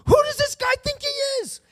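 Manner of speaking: high, loud, outraged squawk; loud, high incredulous challenge; indignant outburst. Acted. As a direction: sound scandalised, voice pushed way up high. Voicing David's outrage.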